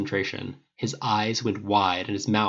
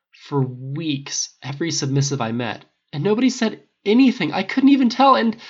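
rising distress; 6 semitones higher, 7 dB louder than usual. Acted.

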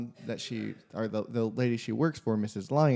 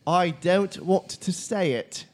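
put-on slow, higher Scouse-accented protest; 7 semitones higher, 4 dB louder, slower than usual. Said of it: "still in accent".

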